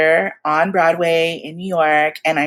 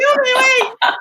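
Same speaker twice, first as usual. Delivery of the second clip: tearful. Retold or imitated. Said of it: "we're just bawling and crying".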